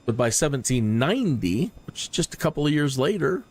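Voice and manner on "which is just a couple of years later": ordinary talk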